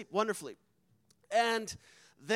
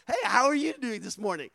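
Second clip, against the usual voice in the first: loud, high, giddy retold greeting; almost giddy; 5 semitones higher, 9 dB louder than usual. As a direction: burst out with an overjoyed hello. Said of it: "like giddy almost".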